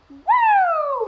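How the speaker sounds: shrieked high, very loud woo; thrilled cheer at the news; excited whoop. Retold or imitated. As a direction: let out a joyful shriek. Learning she goes to Frederick.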